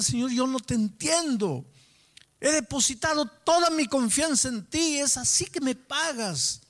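high-pitched, reproachful retold outcry; crying out to God. Sick man alone reproaching God, crying out. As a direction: cry it out high, slowing at the end. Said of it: "Yo gritaba con Dios cuando estaba a solas".

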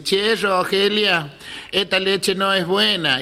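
acted deaf aunt, words drawn out slowly; deaf old aunt. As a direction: drag it out for someone hard of hearing. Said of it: "dos tías mías muy sordas".

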